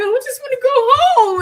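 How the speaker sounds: high, loud whiny wail; hammed-up crying plea; mock crying whine. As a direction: wail it like a tired child.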